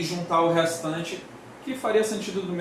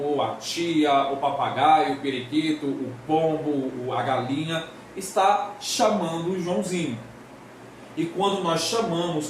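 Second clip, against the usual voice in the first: ordinary talk, nothing marked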